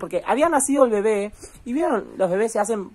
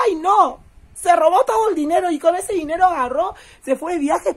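much higher, louder outcry of shock; 8 semitones higher, 5 dB louder than usual. Real, not acted. Telling viewers about the stolen money, shocked. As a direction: gasp it out in disbelief.

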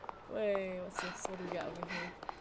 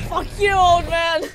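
furious shout; 6 semitones higher, 16 dB louder than usual. Real, not acted. Gamer yelling back at the villain, furious shout. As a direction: yell it with real rage.

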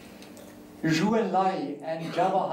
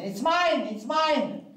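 put-on high-pitched squabbling toddler shout; 11 semitones higher, 3 dB louder, slower than usual. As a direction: yell it like a two-year-old fighting over a toy. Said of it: "So they're shouting".

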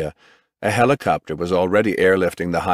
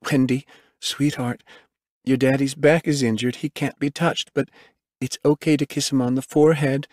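higher woman's voice, explaining gently; medic soothing child; 6 semitones higher than usual. A narrator's reading. Medic to daughter about injured daddy, gently.

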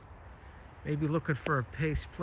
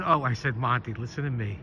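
ordinary delivery

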